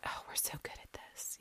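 barely audible whispered aside; whispered thought. Retold or imitated. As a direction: whisper it as a private thought to yourself. Smug thought, voiced as an aside.

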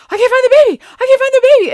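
acted panicked shout, very high and loud; frantic. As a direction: cry out as the panicking husband. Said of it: "and he's frantic".

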